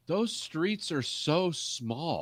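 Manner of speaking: a wide-eyed out-of-towner, drawn out slowly; mock Midwesterner. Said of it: "people from the Midwest are like".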